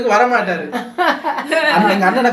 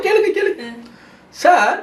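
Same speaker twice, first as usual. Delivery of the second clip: mock eager host; 7 semitones higher, slower than usual. Host inviting the question.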